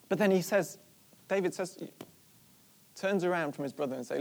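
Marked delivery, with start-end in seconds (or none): none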